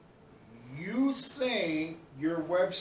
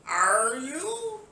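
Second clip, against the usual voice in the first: raised voice through a laugh; laughing challenge; 6 semitones higher, 4 dB louder than usual. Real, not acted. Taunting protesters, laughing and raised. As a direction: challenge them while laughing.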